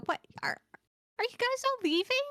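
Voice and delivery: high, whining, pleading tone; whiny voice. Acted. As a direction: whine it like a sulky kid. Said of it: "like, whiny voice and go".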